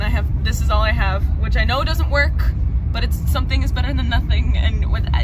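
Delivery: loud voice over train noise; shouting on a subway. Real, not acted.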